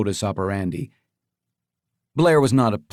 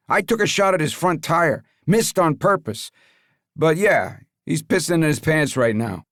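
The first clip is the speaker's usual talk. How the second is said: gruff henchman voice; 6 semitones higher than usual. Acted. Leo voiced.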